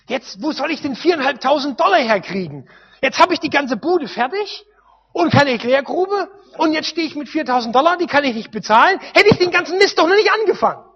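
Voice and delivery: loud, high, exasperated grumbling at himself; a man fretting over money he does not have, loud and upset; retold frustrated self-talk. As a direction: vent the frustration like he is pacing the house. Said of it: "hab gesagt".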